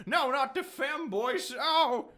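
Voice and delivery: high, slow hammed-up dread; theatrical high-pitched despair; mock horror. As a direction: wail it in fake terror, drawn out. Mock horror at invading femboys.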